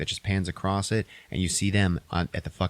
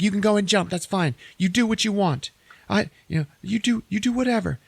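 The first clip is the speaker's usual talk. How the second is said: high, flustered woman voice; acted panicked dismissal; mock freak-out; 12 semitones higher than usual. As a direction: imitate her frantically waving him off. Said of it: "she's, like, ultra freaking out".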